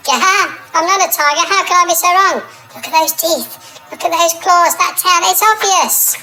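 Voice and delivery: high, excited tiger realising; high excited dismay. Acted. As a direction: squeal it out, amazed at your own mistake.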